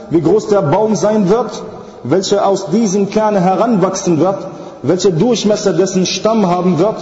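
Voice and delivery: driving sermon cadence, item by item; emphatic, insistent religious oration; rhythmic preaching. Real, not acted. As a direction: build a litany of unanswerable questions.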